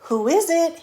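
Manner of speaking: put-on high, loud, sugary old granny voice; wolf faking a sweet granny. Wolf in bed calling out in a fake sweet granny voice. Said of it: "in his sweetest granny voice".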